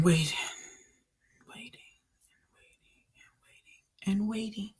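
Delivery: repeated word sinking into a near-silent whisper; fading to a whisper. Real, not acted. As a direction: let each one fade away, with long gaps. Acting out a long wait.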